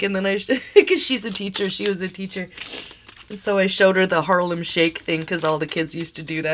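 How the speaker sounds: ordinary talk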